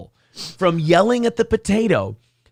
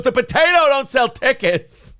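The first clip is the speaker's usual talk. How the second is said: lightly mocking, higher-pitched outraged complaint; Kevin Brennan; 6 semitones higher than usual. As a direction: mimic an indignant complainer, pitched up. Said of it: "he did the Kevin Brennan impression".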